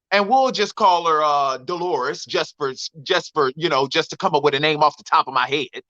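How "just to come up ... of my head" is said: usual speaking voice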